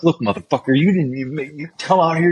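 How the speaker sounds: high-pitched, heated comeback; retold angry challenge. Soldier snapping back at the washout in the motor pool, angry. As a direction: tear into him, voice climbing.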